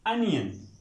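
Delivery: "अनियन" with a short uh first vowel; correct pronunciation. Shown as a demonstration. Teacher corrects the word for students, short first vowel. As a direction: say "अनियन" as UN-yun, falling. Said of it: "correct pronunciation है इसकी अनियन".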